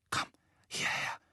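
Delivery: urgent whisper. Acted. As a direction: breathe it, pulling him close. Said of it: "flüsterte Hermine".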